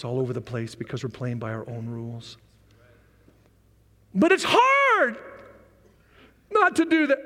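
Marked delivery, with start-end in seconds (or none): 4.1-7.2 very high-pitched whine, "hard" dragged out; mock whining complaint. Congregation objecting to living by the rules, slow high whining. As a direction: wail it like a put-upon complainer. Voices people's complaint about temptation.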